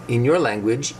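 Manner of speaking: ordinary delivery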